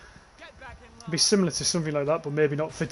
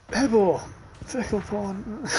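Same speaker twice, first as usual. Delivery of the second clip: drawn-out, higher, mock-solemn reading; mock slow read; 5 semitones higher, much slower than usual. Reading a viewer's silly chat message, drawn out and mocking. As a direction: stretch each word out like a mock announcement.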